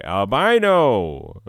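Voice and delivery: slow, loud jab of albino; loud teasing. Acted. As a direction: say it slowly and loudly to tease. Repeating the forbidden word.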